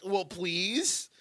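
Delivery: high, put-on plea; mock pleading. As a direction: imitate someone begging for a spot. Quoting the comic begging for stage time.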